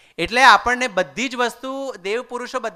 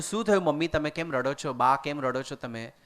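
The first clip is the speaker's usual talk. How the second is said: soft concern; 6 semitones lower, 7 dB quieter than usual. Retold or imitated. Son asks crying mother, retold.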